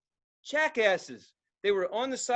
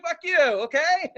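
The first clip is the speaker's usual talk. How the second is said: mock taunt; 8 semitones higher, 6 dB louder, slower than usual. Retold taunt.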